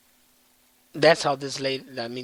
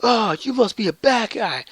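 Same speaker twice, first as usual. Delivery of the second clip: put-on higher, louder excited young hero; hammed-up eager ranger yell; mock Zack; 6 semitones higher, 6 dB louder than usual. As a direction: pitch it up and shout like a brash kid. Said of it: "Zack's like".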